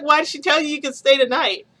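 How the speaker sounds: high, loud incredulous question; shocked disbelief. Retold or imitated. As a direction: hit the pitch up in disbelief. Shocked at being told to host.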